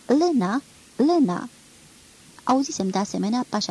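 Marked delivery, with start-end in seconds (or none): 0.1-0.6 loud high call across the platform. A narrator's reading. Woman calls for lost girl on platform, loud shout. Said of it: "un glas de femeie strigând". 1.0-1.5 loud call, falling at the end; calling out again. A narrator's reading. Woman calls the girl a second time, falling shout. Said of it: "un glas de femeie strigând".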